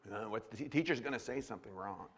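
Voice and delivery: mock a stiff anxious pupil under his breath; uptight nervous kid. Mocking an uptight kindergartner.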